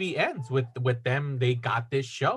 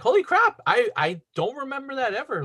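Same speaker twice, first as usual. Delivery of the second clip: retold amazement; 8 semitones higher than usual. Viewer recalling the forgotten sequel teaser, amazed. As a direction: exclaim it in mock shock. Retelling surprise at the ending.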